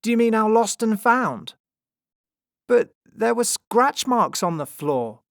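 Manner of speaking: lightly acted loud, high questioning; character dialogue. A narrator's reading. Circus performer and James argue over clues, loud and high.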